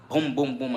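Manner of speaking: loud, high booming knocks; imitated door knocking. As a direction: mimic hard bangs on a door.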